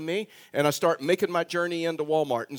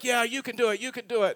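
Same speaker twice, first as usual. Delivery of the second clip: higher, lightly acted coaxing; retold encouragement; 6 semitones higher than usual. Cart man urging the preacher to ride, retold encouragement. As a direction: urge them on in a higher voice.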